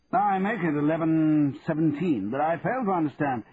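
lightly voiced annoyed lawyer on phone; Birkinshaw voice. A narrator's reading. Birkinshaw answers the time.

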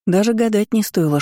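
plain reading voice

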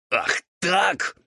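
high, raspy growled challenge; Roul growling. A narrator's reading. Ghoul provoked by devil's laughter, growling. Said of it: "рыкнул Роуль".

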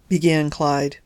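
usual speaking voice

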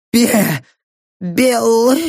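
high, choked half-words; pained stammer. Acted. Dying prisoner tries to answer, stammering. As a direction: choke out broken syllables.

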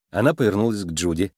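ordinary delivery

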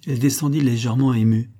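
ordinary delivery, nothing marked

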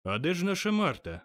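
a mild shout, looking for someone; calling out. A narrator's reading. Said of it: "закричав Боб Кретчет". (легкий вигук, вищим голосом)

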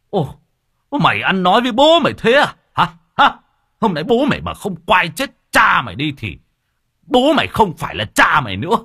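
high, loud enraged yelling; furious father. Acted. Father yelling at his son, high and loud, furious. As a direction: explode at the insolent son. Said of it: "nói như rít".

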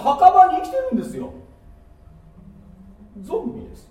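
high, loud climactic sermon; preaching at full force. Acted. Preacher warning the congregation about the graveyard inside, loud and high. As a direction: shout the truth over the crowd.

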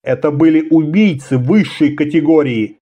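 ordinary delivery, nothing marked

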